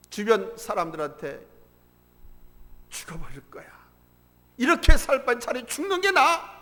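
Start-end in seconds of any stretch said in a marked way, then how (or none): 2.9-6.5 retold bitter complaint. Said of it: "막 짜증하고 원망하고 탄식하는". (짜증과 원망 섞인 한탄 흉내)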